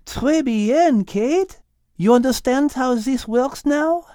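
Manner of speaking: put-on high French-accented teacher voice; french chef. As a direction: teach it like a cheerful French cook. Liz's lines.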